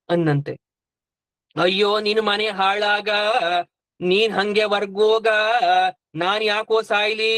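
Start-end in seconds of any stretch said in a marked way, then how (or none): 1.6-7.3 cursing lament with long drawn endings; sing-song wail of protest, hammed up; mock wailing. Elder wife refusing to die for her husband, mock wailing.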